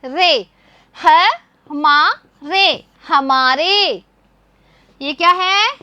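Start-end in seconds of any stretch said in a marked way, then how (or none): 1.0-4.0 syllables ह, मा, रे drawn out slowly, then हमारे joined; sounding out words. Shown as a demonstration. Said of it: "तो क्या बन गया है".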